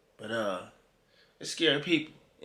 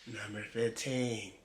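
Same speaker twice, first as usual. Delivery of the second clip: low, hushed, drawn-out countdown voice; mock narrator; 6 semitones lower, 9 dB quieter, slower than usual. Reactor mocking how video narrators announce numbers, hushed mock-narrator voice. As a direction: mimic an ominous narrator, slow and soft. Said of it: "why they try to sound".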